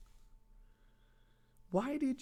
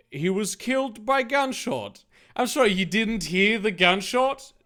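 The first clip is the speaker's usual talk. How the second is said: ordinary delivery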